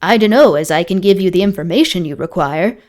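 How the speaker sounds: plain reading voice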